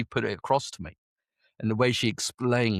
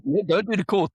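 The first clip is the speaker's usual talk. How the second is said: breezy boss; 9 semitones higher, 4 dB louder than usual. Retold or imitated. QM shooing him along.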